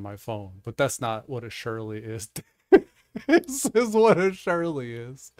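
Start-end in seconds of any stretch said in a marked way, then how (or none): 2.7-5.3 high, loud, slow through real laughter; laughing. Real, not acted. Host presents Bard's right answer, laughing. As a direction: crack up while announcing it.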